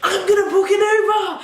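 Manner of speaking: high, huffy declaration; hammed-up huffy announcement; mock indignant yell. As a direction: imitate a fed-up girl storming off. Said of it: "She's like".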